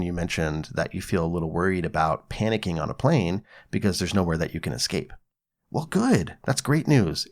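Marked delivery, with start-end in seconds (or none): 5.7-7.3 wry good news. Real, not acted. Ironic welcome of no escape.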